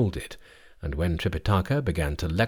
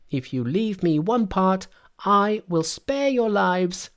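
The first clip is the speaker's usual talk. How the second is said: high-pitched, lordly monkey king; high, cheeky voice of command; haughty Monkey; 11 semitones higher than usual. A narrator's reading. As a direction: pitch it right up and lay down terms.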